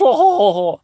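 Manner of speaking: high, loud put-on guffaw; hammed-up haughty laugh; mock posh laugh. Comedian mimicking snobs scorning chips, fake posh laugh.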